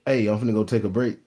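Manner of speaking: ordinary talk, nothing marked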